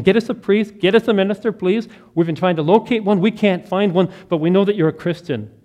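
loud, high, urgent begging; frantic plea. Retold or imitated. Frantic father begging the nurse to find a minister, loud and urgent. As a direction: plead for a priest in a panic. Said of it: "The father is frantic".

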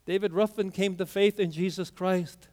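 ordinary delivery

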